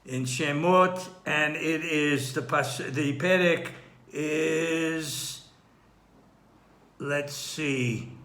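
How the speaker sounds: ordinary talk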